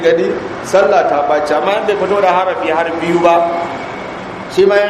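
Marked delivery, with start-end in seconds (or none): none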